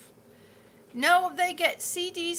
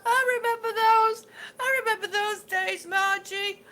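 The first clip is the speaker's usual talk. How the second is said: high laughing reminiscence; 8 semitones higher, 6 dB louder than usual. Real, not acted. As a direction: laugh through the memory. Laughing at memory.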